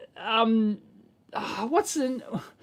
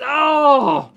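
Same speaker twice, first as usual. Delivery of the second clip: loud, higher drawn-out groan; exasperated groan; 5 semitones higher, 7 dB louder than usual. Real, not acted. Vlogger despairing at a terrible pitch video, exasperated groan.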